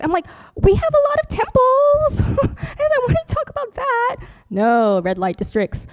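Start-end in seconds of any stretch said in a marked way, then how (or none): 0.6-4.2 squeaky, much higher proud comeback; comic retort. Retold or imitated. Nun answering a travel website that pushes red light districts, squeaky high retort. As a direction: fire it back in a tiny high cartoon voice.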